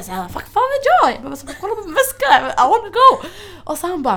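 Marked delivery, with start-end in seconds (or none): 0.3-1.1 high, loud exasperated retort; retold exasperation. Traveller retelling her answer to security, acted exasperation. 1.6-3.3 high, loud, half-laughing protest; retold impatient plea. Traveller retelling her plea to security, acted impatience.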